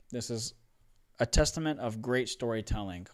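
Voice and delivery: ordinary talk, nothing marked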